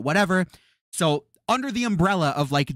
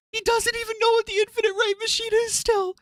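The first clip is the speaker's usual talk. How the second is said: pushed even higher, near squeaking; shrill exasperated squeal. Real, not acted. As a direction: squeal it at the top of the voice. Same words pushed even higher.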